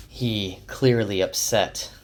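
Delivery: usual speaking voice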